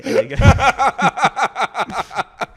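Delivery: slow words broken by high, loud laughter; laughing. Real, not acted. Teller laughs describing the woman kicking off, laughing through words. Laughs while telling the story.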